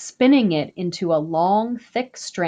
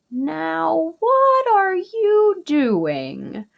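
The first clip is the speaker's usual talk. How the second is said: high, very slow puzzled question; curious neighbor; 9 semitones higher, much slower than usual. A narrator's reading. Neighbor asks Ishel about the drying bags, high and slow. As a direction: stretch each word out in a high, wondering voice. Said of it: "another neighbor asked".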